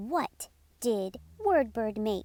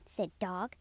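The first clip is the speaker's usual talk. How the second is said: plain reading voice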